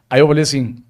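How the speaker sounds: usual speaking voice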